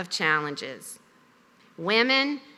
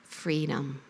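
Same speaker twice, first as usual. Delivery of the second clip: quiet and low, echoing the whisper; hushed, lower repeat of the word; soft repeat; 7 semitones lower, 10 dB quieter than usual. Retold or imitated. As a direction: let it fall softly again. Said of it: "And then he said it again".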